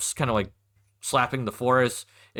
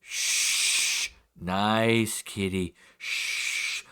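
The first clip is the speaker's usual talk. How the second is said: slow hushing, as if to an animal; calm a dangerous cat with drawn-out words; wary gentleman soothing; 3 semitones lower, much slower than usual. Acted. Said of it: "you hear a gentleman go".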